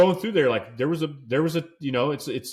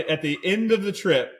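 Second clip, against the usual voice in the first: normal talking voice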